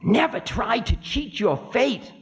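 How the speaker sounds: high-pitched stern warning; high figure of Time. Acted. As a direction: admonish in a high, thin voice. Time warns Ravana, voice high.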